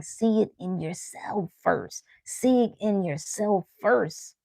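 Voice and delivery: soft and slow, like an inner voice speaking; spirit's gentle voice. Pretend. Your spirit gently telling you to look within, softly. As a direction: let the words settle slowly and softly. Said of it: "your spirit is saying".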